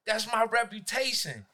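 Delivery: ordinary talk, nothing marked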